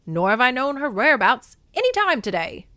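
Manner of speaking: put-on quick, loud old-movie patter; nineteen-forties actor. Hermes swears innocence to Demeter, old-movie actor voice. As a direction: play it like a forties film actor. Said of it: "nineteen forties actors".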